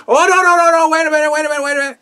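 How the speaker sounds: Piper placating. Retold or imitated. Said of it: "Piper would be like".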